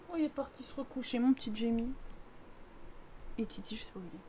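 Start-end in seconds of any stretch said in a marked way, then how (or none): 3.4-4.2 hushed unvoiced whisper. Real, not acted. Streamer whispering about the cat at night, unvoiced whisper. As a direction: whisper so the house stays asleep.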